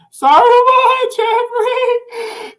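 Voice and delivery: high, slow, giggling apology; laughing correction. Real, not acted.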